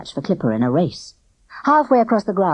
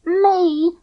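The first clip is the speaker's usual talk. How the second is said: high, louder indignant me; protesting; 12 semitones higher, 4 dB louder than usual. A narrator's reading. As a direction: protest his innocence. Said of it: "protested Barney".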